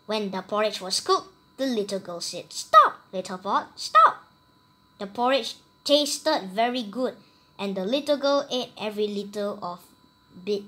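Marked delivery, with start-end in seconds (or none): 2.5-4.2 last stop leaps up high and loud; a child's bold magic command; commanding the pot. Acted. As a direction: order the pot to halt. Girl orders the pot to stop.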